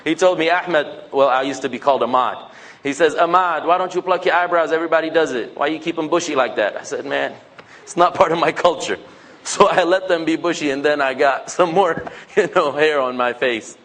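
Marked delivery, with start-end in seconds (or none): none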